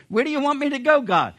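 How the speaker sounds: high, loud startled question; Moses. Acted. Prophet questioning God's orders, loud mock alarm. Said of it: "He said".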